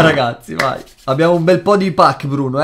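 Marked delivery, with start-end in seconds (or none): none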